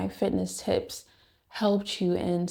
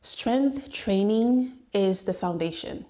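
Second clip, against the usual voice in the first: ordinary talk, nothing marked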